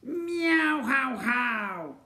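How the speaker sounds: high, loud meowing cat laugh. Acted. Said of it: "that is how cats laugh".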